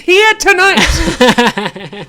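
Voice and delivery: very loud, high, drawn-out hype announcement; mock announcer shout. Joking that the favorite rapper is here, mock announcer shout. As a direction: introduce a surprise star to a roaring crowd.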